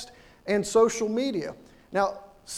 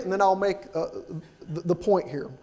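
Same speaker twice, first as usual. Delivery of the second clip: ordinary talk, nothing marked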